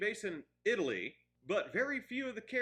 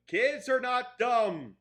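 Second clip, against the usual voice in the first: emphatic; 5 semitones higher, 7 dB louder, slower than usual. Real, not acted. Ranting about over-explaining movies.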